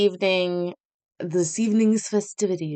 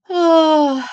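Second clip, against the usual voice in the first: normal talking voice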